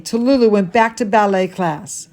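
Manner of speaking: ordinary delivery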